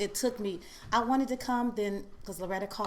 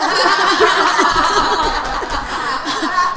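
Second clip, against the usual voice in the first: long, loud burst of laughter; 10 semitones higher, 17 dB louder than usual. Real, not acted. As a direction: crack up at your own story. Bursts laughing at her own story.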